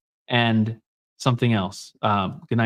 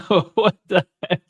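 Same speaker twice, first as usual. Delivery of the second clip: laughing; 5 semitones higher than usual. Real, not acted. Laughing at partner's odd behavior.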